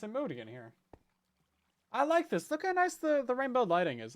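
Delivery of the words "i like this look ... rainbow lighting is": delighted. Real, not acted. Delighted by lighting.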